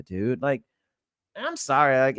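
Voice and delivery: usual speaking voice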